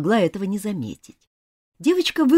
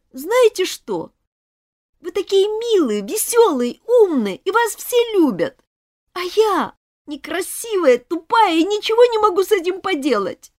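put-on higher, tearful girl's outburst; a girl confessing to her classmates through tears, higher; tearful confession; 9 semitones higher, 4 dB louder than usual. A narrator's reading. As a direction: choke back tears while confessing. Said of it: "глотая слёзы, дрожащим голосом".

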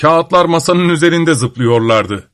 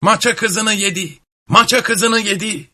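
jeering devils; 8 semitones higher than usual. A narrator's reading. Devils taunt grandfather for losing the hand, jeering high. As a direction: jeer in a high chanting repeat. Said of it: "her yandan kişnemeye, havlamaya, böğürmeye başladılar".

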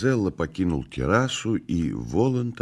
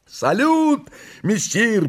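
high-pitched exuberant cry; cried greeting; 4 dB louder, slower than usual. A narrator's reading. The restless pair greeting messire, high cry. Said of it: "прокричала неугомонная парочка".

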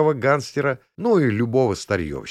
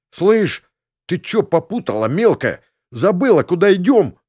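russian street thug; 9 semitones higher, 6 dB louder than usual. Acted. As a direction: bark at her like a gopnik. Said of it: "Kuker scolds tipsy Shpilka".

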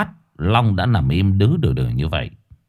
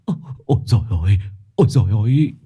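stammering; much faster than usual. Acted. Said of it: "lắp bắp".

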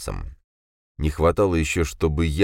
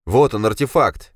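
mocking; 6 semitones higher, 5 dB louder than usual. A narrator's reading. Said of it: "насмешливо оскалился Дима".

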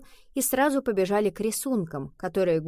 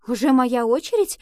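higher, louder, flustered asking; excited question; 6 semitones higher, 8 dB louder than usual. A narrator's reading. Rhea asks whether her turn has come, excited. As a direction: ask it keyed up, pitch raised. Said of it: "взволнованно спросила она".

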